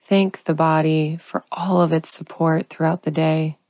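ordinary talk, nothing marked

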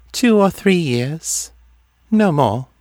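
high, detached oracle voice; distant. A narrator's reading. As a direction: pitch it high and far away, as if dreaming.

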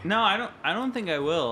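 whiny nerd objecting. Pretend. Host refusing to learn belly speaking, nerdy protest. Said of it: "talk like a nerd".

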